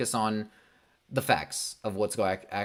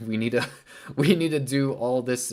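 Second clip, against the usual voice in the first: laughing; 3 semitones higher, 5 dB louder than usual. Retold or imitated. Mocking imagined exec, laughing.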